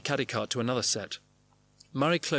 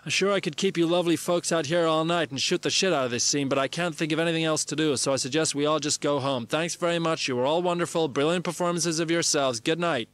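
raised, higher voice thanking the crowd; loud announcement; 4 semitones higher, 4 dB louder than usual. Acted. Bob signing off to the assembled stars, loud announcing. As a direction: project it like a host signing off. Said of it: "Bob announces".

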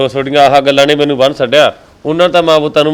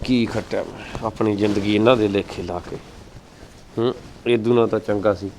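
much lower, soft, heavy-hearted sigh of regret; sad lament; 7 semitones lower, 11 dB quieter than usual. Acted. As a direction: brood over a wasted life quietly. Uncle's sad self-pitying lament.

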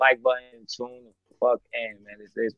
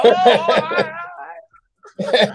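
mock agreement; 7 dB louder, slower than usual. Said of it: "I'm like".

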